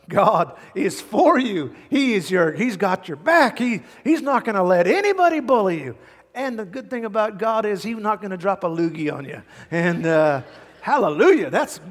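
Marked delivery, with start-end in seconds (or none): 0.1-6.0 preaching at full force. Real, not acted. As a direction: proclaim it to the back row. Preacher's climax, God has your back.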